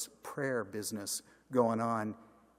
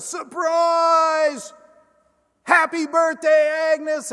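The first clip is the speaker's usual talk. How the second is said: much higher and louder joyful yell; surprise shout; 12 dB louder, much slower than usual. Retold or imitated. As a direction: shout it like a crowd springing a surprise. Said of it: "greeted with a shout of".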